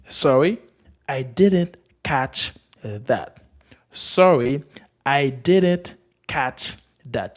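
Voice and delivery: very slow, word by word; slow model phrase. Shown as a demonstration. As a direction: space out each word for learners. Teacher models phrase for learners.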